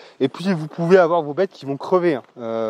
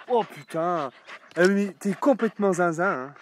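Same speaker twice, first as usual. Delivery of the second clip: ordinary talk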